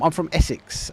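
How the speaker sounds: normal talking voice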